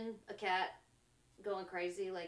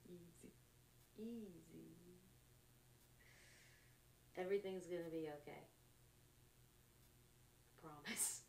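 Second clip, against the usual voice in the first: very soft, low, reassuring murmur; hushed soothing; 4 semitones lower, 16 dB quieter, faster than usual. Pretend. Telling a panicking listener everything's okay, hushed and soothing. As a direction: talk someone down from panic, barely audible. Acts out calming someone down.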